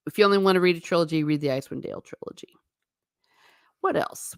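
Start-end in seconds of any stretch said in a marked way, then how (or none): 3.8-4.4 what else, breathed out in a whisper. Real, not acted. Vlogger musing to viewers in a whisper. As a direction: whisper it to yourself, thinking.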